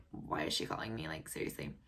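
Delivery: quiet irritated mumble; muttered aside. Retold or imitated. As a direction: mutter it to yourself, annoyed. Annoyed muttering to herself.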